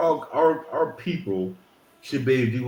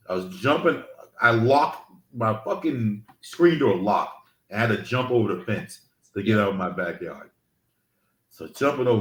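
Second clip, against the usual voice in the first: ordinary talk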